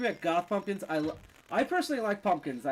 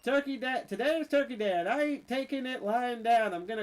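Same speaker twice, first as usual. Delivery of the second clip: put-on southern accent; 7 semitones higher than usual. Streamer voicing a game villager's Turkey Day boast in a hammed-up Southern accent. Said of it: "I don't know why I have a southern accent".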